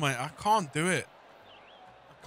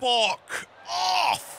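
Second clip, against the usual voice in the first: frustrated shout; 7 semitones higher than usual. Real, not acted. Angry at the ball.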